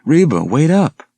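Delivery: high, loud call to a friend ahead; calling out while running. Acted. Girl calling to Reba ahead, high loud call. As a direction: shout after her, breathless from jogging.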